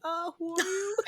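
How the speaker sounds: high, hesitant puzzlement; puzzled reply. Retold or imitated. Host answering someone who recognizes her, imitated puzzlement.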